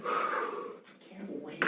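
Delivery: hushed, very slow creepy whisper; Yanti voice. Acted. Yanti NPC bidding the prisoners farewell, creepy whisper. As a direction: draw it out in a sinister whisper.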